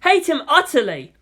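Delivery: high-pitched, distressed lament; anguished outburst. Acted. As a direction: wail it in despair, each phrase falling away. Boy's anguished thoughts in story.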